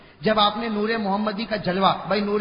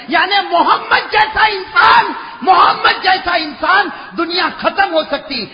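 high, loud sermon cry; fiery preaching; 9 semitones higher, 9 dB louder, slower than usual. Acted. Preacher stirring the crowd, fiery preaching. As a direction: preach it out with fervour.

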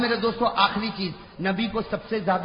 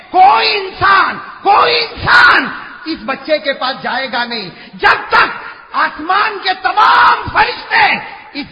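high, loud, slow decree; commanding angel; 10 semitones higher, 9 dB louder, slower than usual. Acted. Gabriel telling the grandfather God's command, loud decree. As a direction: pronounce God's order with force.

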